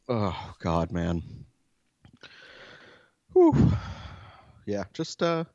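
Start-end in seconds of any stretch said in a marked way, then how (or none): 3.3-3.7 recovery exhale. Real, not acted. Recovering after choking.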